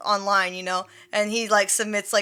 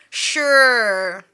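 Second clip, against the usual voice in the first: louder, drawn-out disbelieving sure; loud sarcastic sure; 7 dB louder than usual. Retold or imitated. Rejecting the man's sister excuse, with a loud sarcastic sure. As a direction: really lean on the sarcasm.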